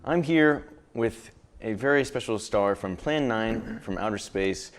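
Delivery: ordinary delivery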